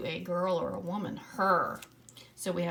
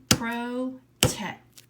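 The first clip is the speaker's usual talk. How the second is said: "Protect" louder, split into pro and tect; clapping syllables; 4 semitones higher, 8 dB louder than usual. Shown as a demonstration. Teacher clapping out protect with the class. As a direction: clap out pro, tect, loud. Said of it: "clapping out syllables".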